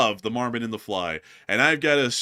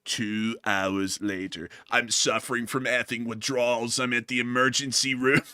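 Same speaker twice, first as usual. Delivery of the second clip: The Fly. Pretend. Host voices The Fly character.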